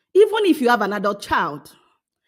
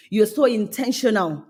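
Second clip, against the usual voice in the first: ordinary talk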